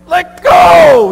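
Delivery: high, loud exasperated shout; shouted plea. Retold or imitated. Parents shouting at their daughter to let go. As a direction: yell it at a baby who won't let go. Parents pleading with screaming baby.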